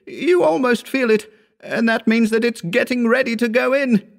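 very high, frantic raving voice; delirious gibbering. A narrator's reading. Injured Wells raving to his carriers, high delirious gibbering. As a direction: rave on like a terrified victim, pitched far up. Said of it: "issued a torrent of gibberish".

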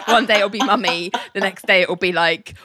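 normal talking voice

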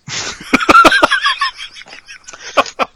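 loud laugh that falls away; big burst of laughter. Real, not acted. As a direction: crack up out loud. Laughing at his own joke.

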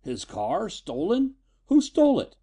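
alarmed disbelief, rising to a shout; a sharp, startled cry; shocked. Acted. Father alarmed by stolen car.

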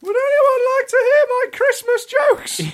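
very high falsetto celebrity impression; Emily Blunt. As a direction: squeak it in a silly high voice. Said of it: "Definitely Emily Blunt".

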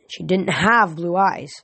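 ordinary delivery

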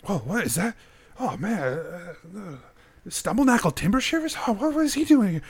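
lightly voiced puzzled onlookers, rising high; mild confused gawking; bewildered townsfolk.

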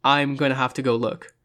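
ordinary delivery

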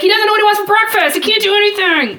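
acted-out high, fast protest; comic exasperation, rushed and high; exasperated retort. Retold exasperated outburst about Jon.

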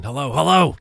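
loud, high mock phone yell; imitated yelling. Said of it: "he starts yelling".

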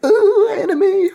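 very high, laughing squeak of alarm; squeaky cartoon voice. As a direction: squeal it like a startled cartoon kid. Gonk voiced spotting the princess.

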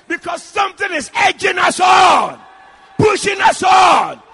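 loud, driving pulpit exhortation; fervent preacher at full volume; full-force preaching. Real, not acted. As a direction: fire up the congregation in short, ringing phrases.

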